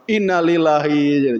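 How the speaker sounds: slow, drawn-out falling lament; mock dismay. Debtor told his land must be sold, mock dismay. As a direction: act out comic letdown.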